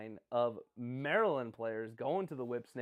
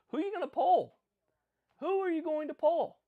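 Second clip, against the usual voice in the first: high and loud taunting question; gloating; 14 semitones higher, 6 dB louder than usual. Real, not acted. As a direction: dare the other teams. Gloating about his midfield.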